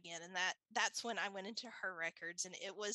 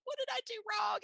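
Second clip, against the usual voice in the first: high, panicky mock wail; retold panic; 12 semitones higher, 4 dB louder, faster than usual. Blaming herself for the refusal, high mock panic. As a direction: act out alarmed self-blame. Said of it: "started hyperventilating".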